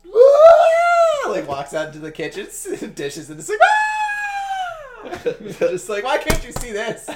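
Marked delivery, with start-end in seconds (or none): 0.1-1.3 long, loud, high-pitched shriek; mock scream. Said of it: "she screams at dishes". 3.6-5.5 prolonged piercing falsetto shriek; mock scream. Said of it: "she screams at dishes".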